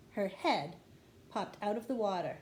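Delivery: plain reading voice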